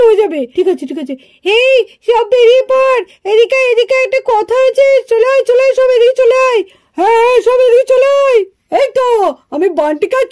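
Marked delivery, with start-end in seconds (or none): 1.6-9.1 higher pitched shout across the woods; calling out. Acted. Boy calling playmates out of their hiding places, high shout.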